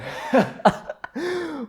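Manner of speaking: high-pitched helpless laugh; high squealing laugh. Real, not acted. Laughing at the spicy soup.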